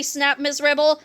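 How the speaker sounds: usual speaking voice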